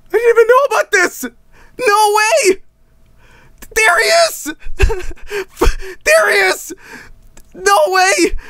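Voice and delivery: very high squeal, cracking into laughter; ecstatic disbelief. Real, not acted. Streamer finding the original John Norton software, ecstatic squealing. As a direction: squeal it like you just found treasure.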